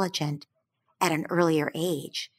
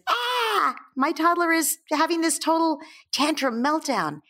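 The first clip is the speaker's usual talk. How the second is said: панический возглас; 9 semitones higher than usual. Acted. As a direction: act out an alarmed parent, pitched up. Parent panicking at a toddler's meltdown.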